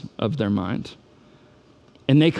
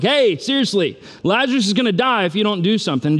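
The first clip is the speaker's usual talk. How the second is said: raised-pitch, insistent mock plea; urgent plea; 7 semitones higher than usual. Family pleading with Jesus as Lazarus worsens, urgent and insistent. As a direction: press the acted warning urgently, higher.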